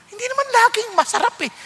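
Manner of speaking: ordinary talk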